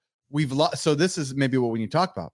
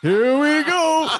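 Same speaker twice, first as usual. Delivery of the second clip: excited shout; 10 semitones higher, 4 dB louder, much slower than usual. Pretend. Excited old-man cheer.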